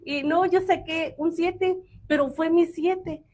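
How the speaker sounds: put-on teen friends shrugging it off, lightly laughing; carefree teen friends. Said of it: "este grupo de amigas le decían".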